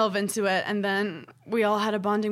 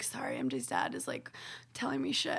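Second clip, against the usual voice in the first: low, hushed confidential aside; acted hushed mock apology; 8 semitones lower, 10 dB quieter than usual. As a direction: drop to a near-whisper, as if excusing yourself. Distracted friend apologising, relaying spirit's chatter.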